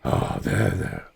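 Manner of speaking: low, soft comforting murmur; tender soothing. Acted. Adventurer to dragon rubbing against shoulder, tender soothing. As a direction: soothe it gently and quietly.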